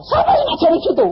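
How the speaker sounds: high, loud, accusing question; indignant demand. Retold or imitated. Husband angrily demanding who taught his wife this. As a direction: demand to know who's behind this.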